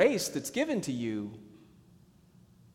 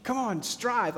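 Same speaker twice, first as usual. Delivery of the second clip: pitched-up urging push; striving push; 8 semitones higher, slower than usual. Retold or imitated. Pastor mimicking his own striving habit, pushy urging. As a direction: egg yourself on, half-mocking. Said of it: "You can hear it in my voice".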